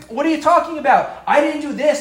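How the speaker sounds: high, loud, defensive protest; loud indignant denial; defensive retort. Retold or imitated. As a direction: snap back in self-defence. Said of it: "And say".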